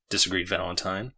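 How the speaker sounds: usual speaking voice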